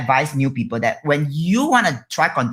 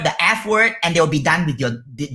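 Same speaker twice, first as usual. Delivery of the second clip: ordinary talk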